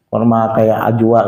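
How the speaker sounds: ordinary delivery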